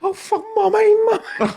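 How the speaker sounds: very high, loud wailing for mummy; hammed-up distressed squeal; mock panicked wail. Sister seeing mum in the fight, mock panicked wail.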